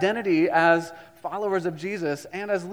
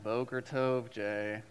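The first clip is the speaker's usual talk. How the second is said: groggy class reply; 7 semitones lower, 7 dB quieter, slower than usual. Retold or imitated. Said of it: "so we're like".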